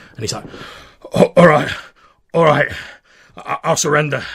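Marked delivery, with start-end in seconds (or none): 1.1-4.2 loud, higher, ragged gasping give-up; ragged breathless surrender. Acted. Wounded bandit surrendering to the ranger, ragged and breathless. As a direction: gasp out the surrender. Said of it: "ragged voice... quite breathless".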